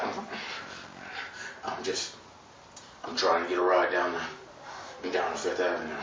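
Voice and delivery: put-on low, soft mumbling old man; a gummy old-timer. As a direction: mumble it like gums slipping. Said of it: "old people talk like that".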